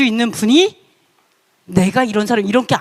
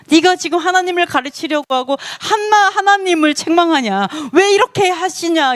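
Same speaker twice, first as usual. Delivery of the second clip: higher-pitched, scolding challenge; stern rebuke; 5 semitones higher than usual. Retold or imitated. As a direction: voice God taking Job to task. Voicing God's rebuke of Job. (꾸짖듯 따지는 높은 목소리)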